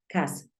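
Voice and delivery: "cousin" as KAH-sen, falling softly; correct cousin pronunciation. Shown as a demonstration. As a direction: model "cousin" with an AH, not OH. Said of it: "Así es como se pronuncia".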